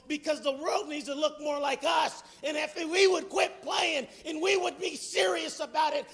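rising pulpit crescendo, slowing at the end; building preaching. Acted. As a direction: hammer each "we gotta quit" harder. Building preaching run, repeated "gotta quit.

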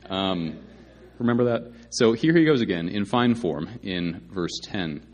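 ordinary talk

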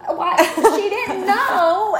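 very high, slow, laughing wail; great aunt defending Morgan in a high laughing wail; crazy old aunt. Retold or imitated. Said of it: "my crazy old Aunt Laverne going".

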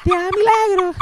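put-on very high child voice doing stand-up; little kid comedian. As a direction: play a tiny kid delivering his bit. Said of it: "cuando yo era chiquito yo le decía eso". (niño chiquito haciendo su rutina, voz muy aguda)

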